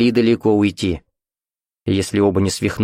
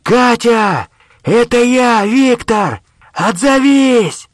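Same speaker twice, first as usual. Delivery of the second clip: high, loud long-distance call; full-voiced searching shout; 14 semitones higher, 6 dB louder, much slower than usual. A narrator's reading. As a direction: holler through cupped hands, stretching the last word. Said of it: "сложил ладони рупором и крикнул".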